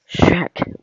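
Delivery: sharp, breathy alarmed shout; alarmed call. Acted. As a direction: call his name in a panic. Calling out in alarm.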